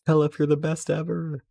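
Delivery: high, thrilled cry of gratitude; gushing thanks. Retold or imitated. Streamer thanking Caliph for the stickers, high and thrilled. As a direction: gush over the gift like a delighted kid. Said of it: "And I was like".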